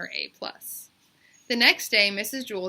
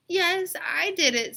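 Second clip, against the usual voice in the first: put-on higher, slow and firm, a young girl insisting; girl's insistent voice; 10 semitones higher, 6 dB louder, slower than usual. A narrator's reading. Bebe insisting to Calvin she did her homework, higher and slow. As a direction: draw out the yes like a stubborn kid.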